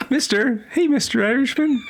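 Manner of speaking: put-on high-pitched child calling out; kid. Kid calls to the Irishman, high childlike voice. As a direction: pipe up like a little kid. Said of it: "Well, it's like a kid".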